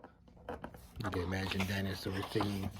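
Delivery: quick murmured aside to the listeners; soft, rushed side comment; hushed aside. Real, not acted. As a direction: drop out of the story and mutter it fast.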